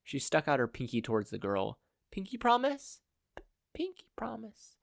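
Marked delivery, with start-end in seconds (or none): 2.1-3.0 much higher, gentle rising ask; playful pinky promise. Acted. Kathleen offers Eri her pinky, gentle and playful. 3.4-4.8 much higher and much softer, stuttered through tears; a tearful little girl accepting the promise; sniffling. Acted. Crying girl hooks her pinky with Kathleen's, sniffling. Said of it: "Eri sniffled".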